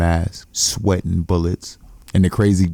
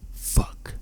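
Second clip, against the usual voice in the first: whispered curse; 9 dB quieter than usual. Acted. Muttered curse after errand order.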